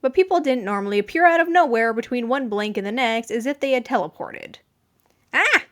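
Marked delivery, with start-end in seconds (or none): 5.3-5.6 a sharp high yelp, much higher and louder; short high startled cry. A narrator's reading. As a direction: jump with a sudden yelp of fright. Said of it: "Atsushi yelped".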